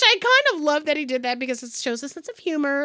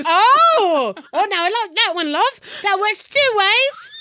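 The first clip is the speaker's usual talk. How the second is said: a touch of an Irish lilt, playful; hint of Irish; 4 semitones higher, 3 dB louder than usual. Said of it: "can he have an Irish accent".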